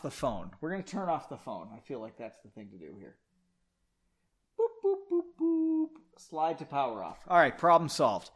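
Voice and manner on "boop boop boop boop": high, monotone boop noises; light, high-pitched "boop, boop, boop, boop"; spoken boops. Real, not acted. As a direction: say boop like little phone beeps. Imitates phone beeps while powering off.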